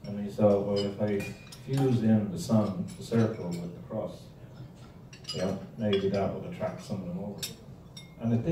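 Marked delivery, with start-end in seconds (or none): none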